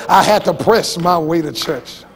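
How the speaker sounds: lightly voiced weary churchgoer, low; mock the tired testimony with a sigh in it; weary testifier. Preacher imitates a worn-out member testifying at church. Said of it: "you ought not be saying".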